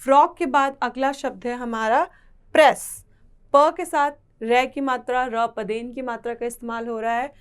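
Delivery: ordinary talk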